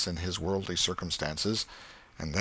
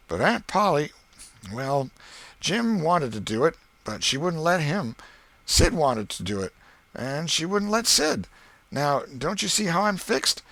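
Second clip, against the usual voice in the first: put-on boy Tom, sly; 7 semitones higher than usual. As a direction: play it cool and sly like a scheming kid. Tom voiced in story.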